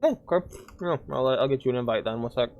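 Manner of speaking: talking through chips; chewing while talking; mouth full. Real, not acted. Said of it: "Sorry, I'm just eating chips".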